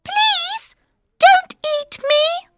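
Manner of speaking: very high squeaky mouse pleading; tiny mouse voice. Acted. Mouse begs the lion not to eat him, squeaky. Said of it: "says the mouse".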